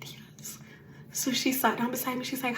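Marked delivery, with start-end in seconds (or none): 0.0-0.6 breathless whispered "Yes"; faint whispered yes. Retold or imitated. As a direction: whisper it, almost no voice. Answering facilitator in a weak whisper.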